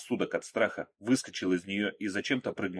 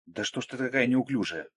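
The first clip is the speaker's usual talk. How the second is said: mildly exasperated, higher-pitched scolding; exasperated cry; 4 semitones higher than usual. A narrator's reading. Irene scolding Margo, exasperated cry. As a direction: snap it with a touch of annoyance. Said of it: "в сердцах крикнула Ирен на Марго".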